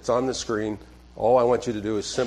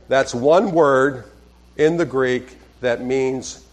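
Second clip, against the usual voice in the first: slow, louder, one pressed hard; emphatic; 4 dB louder, slower than usual. Shown as a demonstration.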